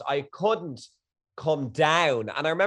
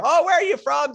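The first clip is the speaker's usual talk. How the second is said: much higher and louder mock meet-and-greet; excited greeting; 12 semitones higher, 7 dB louder than usual. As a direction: imitate gushing at newfound compatriots. Acts out excited chat with strangers.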